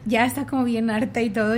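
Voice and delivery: usual speaking voice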